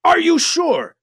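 high, loud questioning; Kat's voice. A narrator's reading. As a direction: ask in dismay as Kat. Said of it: "Kat asked".

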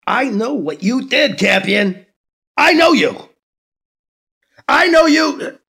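rising into a loud, high shout; building rage. Acted. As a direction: lose control word by word. Candidate loses control.

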